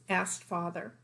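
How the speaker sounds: plain reading voice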